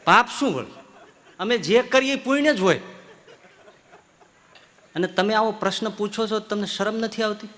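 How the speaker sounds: high, scornful, offended holy man; indignant sadhu. Acted. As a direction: scoff down at the questioner from high up. Arrogant sadhu scolds the questioner. (ઊંચા, તિરસ્કારભર્યા અવાજે ગુસ્સે થયેલો સાધુ)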